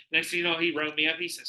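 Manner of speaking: usual speaking voice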